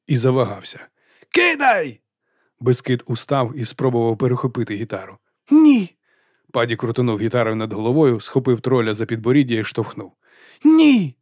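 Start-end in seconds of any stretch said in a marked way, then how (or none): 1.3-2.0 high sharp command; urging Padi to throw the guitar, high sharp shout; urgent shout. A narrator's reading. Panic on runaway cart. 5.5-5.9 loud, much higher cry of no; the troll crying out as the guitar is grabbed, loud high scream; desperate cry. A narrator's reading. Struggle over guitar. 10.6-11.2 loud, much higher no; the troll crying out as he is pushed, loud high scream; desperate cry. A narrator's reading.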